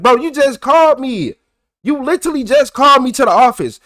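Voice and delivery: mock-argue that he just called you; retold heated protest. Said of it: "So now I'm mad".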